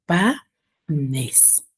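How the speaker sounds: "panes" drawn out in two beats, pa - nes; split into syllables. Shown as a demonstration. Teacher counting voice impulses, "panes" syllable by syllable. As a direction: slowly break "panes" into pa, pause, nes. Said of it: "Cuántos impulsos de voz yo pronuncio".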